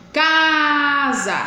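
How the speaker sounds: "Casa" with a long, high CA; CAAA-sa, as a stress demo; stressed syllable drawn out. Shown as a demonstration. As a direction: hold the first syllable of "Casa", then drop to SA. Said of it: "demorar mais com ela na boca".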